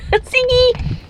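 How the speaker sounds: pet voice. Real, not acted. Talking to her pet on camera.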